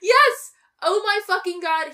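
high, loud outburst of agreement; emphatic agreement. Real, not acted. Podcaster heatedly agreeing about a hated movie character, emphatic agreement. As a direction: agree at full volume, exasperated.

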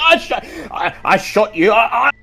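high, panicked stammer. Acted. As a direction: trip over every word in shock.

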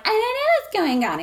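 high, quick protest in imitation; mock innocent denial. Friend voicing the accused contestant, high mock-innocent denial. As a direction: play up the clueless innocence.

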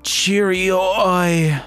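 high, drawn-out mock British cry; bad British accent. Reader jokes a British moan, badly faked British accent. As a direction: ham up a terrible British accent. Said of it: "doing a bad job at any accent".

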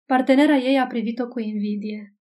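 ordinary delivery, nothing marked

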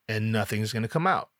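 ordinary delivery

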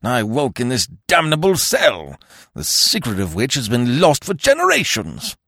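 torrent of pent-up wrath; high, raging shout easing to indignant bluster; Sir Lionel Barton. A narrator's reading. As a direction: rant furiously, starting very high and loud. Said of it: "unloosed a torrent of pent-up wrath".

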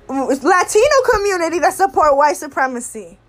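ordinary delivery

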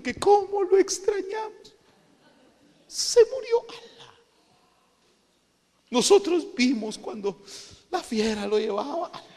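high-pitched, hammed-up wailing; mock sobbing tale, pitch climbing sharply; feigned tearful lament. Brothers lie to José about the lost brother, mock wailing. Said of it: "hasta han de haber llorado".

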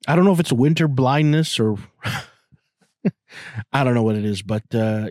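ordinary talk, nothing marked

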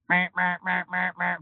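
evenly repeated alarm buzzes; imitated alarm clock beeping. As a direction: mimic a droning alarm going off. Imitates the morning alarm going off.

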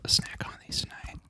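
hushed whisper. Real, not acted. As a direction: whisper the thanks. Whispered thanks.

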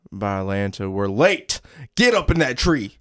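Southern father, building to an order; Southern accent. Acted. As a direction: rise into an urgent Southern command. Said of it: "Southern accents?".

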